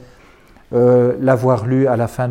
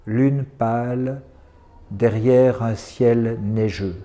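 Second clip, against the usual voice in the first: very slow, low and subdued recital; poem, repeated softly; 3 semitones lower, 4 dB quieter, slower than usual. Acted. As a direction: let the line sink, softer, like an echo. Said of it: "je vais les dire deux fois". (reprise du poème, lente, basse et douce)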